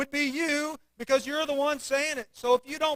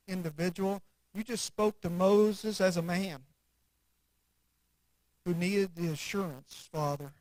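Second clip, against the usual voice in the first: prayer; 4 semitones lower than usual. Acted. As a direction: pray it gently then rise in fervour. Closing prayer.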